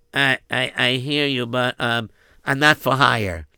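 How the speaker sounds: put-on the shy boy. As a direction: answer timidly, humbly. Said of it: "boy refusing the count".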